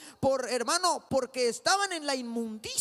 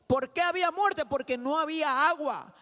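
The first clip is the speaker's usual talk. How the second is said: rising call and answer, louder and higher; preaching at full force; 4 semitones higher, 4 dB louder than usual. Acted. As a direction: preach it, build each answer.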